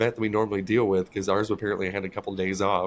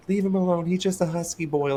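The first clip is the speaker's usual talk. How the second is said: higher, cooing mock voice; teasing vet; 8 semitones higher than usual. Vets defending the fat cat, high cooing tease. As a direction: coo it like doting on a chubby pet.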